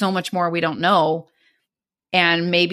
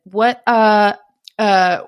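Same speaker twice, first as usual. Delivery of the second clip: hesitant stalling; 3 semitones higher, 7 dB louder, much slower than usual. Retold or imitated. Fiancé stalling for words, retold.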